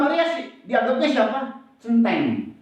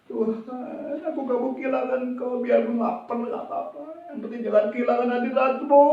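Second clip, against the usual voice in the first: high, yearning prayer; earnest pleading prayer; 6 semitones higher, 4 dB quieter than usual. Acted. Preacher acts a believer praying to keep God's presence, pleading. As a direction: plead with God, starting soft and gaining force. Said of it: "Mereka semakin berdoa".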